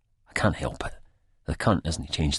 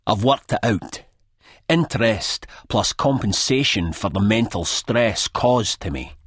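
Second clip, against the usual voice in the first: smug accounting; 5 semitones higher, 3 dB louder, slower than usual. A narrator's reading. Sick Boy lists demands.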